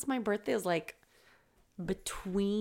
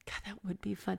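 whispering; 9 dB quieter than usual. Real, not acted. Excited whisper about vintage party.

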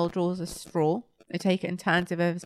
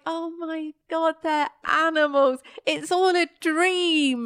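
mock amazed realisation; 9 semitones higher, slower than usual. Realising the toys are animals.

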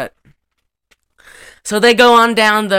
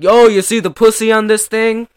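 louder, higher mock wisecracker; crude joker; 5 semitones higher, 7 dB louder than usual. Mocking Stallone's joke to the table, as a crude joker. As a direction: call out a bad crude joke. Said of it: "bad Rodney Dangerfield jokes".

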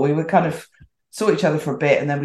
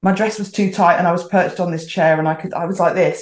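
ordinary talk, nothing marked

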